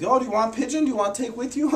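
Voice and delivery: very high, loud imitation of a teacher offering; eager offering teacher. Teacher offering a pigeon as the student leaves, imitated eager offer. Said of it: "he offers me, he's like".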